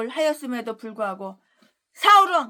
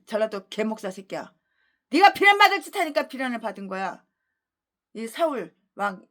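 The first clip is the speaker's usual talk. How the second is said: normal talking voice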